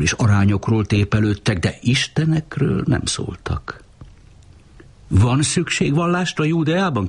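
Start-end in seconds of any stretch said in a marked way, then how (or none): 5.1-7.1 much higher, pressing question; urgent. A narrator's reading. Tia to Uri, demanding an answer about Judea, urgently. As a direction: press him hard for an answer. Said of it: "kérdezte Tia sürgetőleg".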